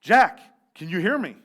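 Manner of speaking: loud voice calling down; calling back. A narrator's reading. A voice from above answers Jack, loud calling out. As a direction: call down to him from above. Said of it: "a voice calls back".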